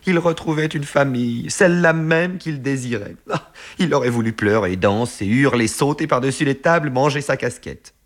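high, excited narration; carried away with delight; elated. A narrator's reading. Narrator telling of a son finding his longed-for family, high excited rush. Narrator voices Bossu's elation.

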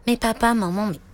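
lightly voiced pleading boy. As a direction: stammer the question like a curious kid. Noni pleads with parents.

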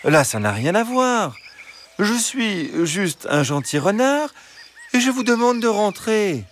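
high, patient fox voice; reasoning fox. Acted. Fox arguing against La Fontaine, high and patient. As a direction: explain kindly as the fox. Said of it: "Le renard reprit".

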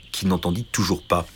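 ordinary delivery, nothing marked

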